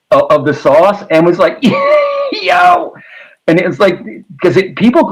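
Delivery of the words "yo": high laughing yelp of Yo!. Retold or imitated. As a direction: squeal out a laughing Yo! as the friend. Friend crying from hot wings.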